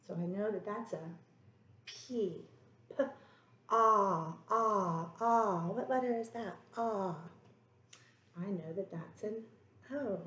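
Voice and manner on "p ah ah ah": "ah, ah, ah" loud and open, repeated; sounding out vowel. Shown as a demonstration. Teacher sounding out the vowel in pond. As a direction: open wide on each "ah". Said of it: "The next sound I hear in pond".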